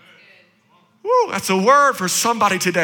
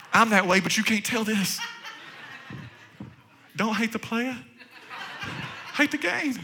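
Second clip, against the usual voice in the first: normal talking voice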